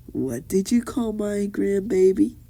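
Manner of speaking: menacing grandmother, held high and threatening; Tsunade. Retold or imitated. Tsunade confronting Sakura over her grandchild, evil voice. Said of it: "Tsunade in the evil voice says".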